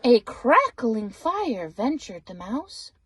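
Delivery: ordinary delivery, nothing marked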